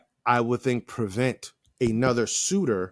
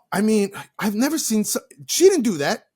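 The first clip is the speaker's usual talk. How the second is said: ordinary talk, nothing marked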